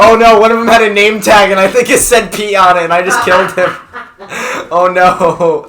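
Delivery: high, loud guilty laughter; guilty laughing. Real, not acted. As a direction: laugh out the confession. Guilty after killing Pete.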